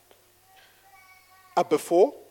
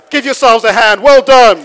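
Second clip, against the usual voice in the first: high, loud cheer; hyped-up crowd shout; 6 semitones higher, 14 dB louder than usual. Real, not acted. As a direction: whip the room into applause. Cheering the audience.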